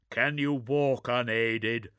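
curt soldier's question; gruff space marine. Acted.

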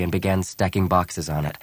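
ordinary delivery, nothing marked

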